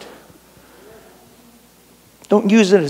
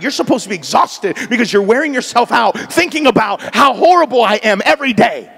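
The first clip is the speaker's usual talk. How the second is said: ordinary talk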